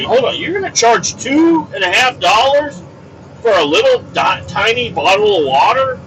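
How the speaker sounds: high-pitched, indignant questioning; worked-up mock outrage; incredulous objection. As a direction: challenge the price in comic disbelief. Acted objection to water prices.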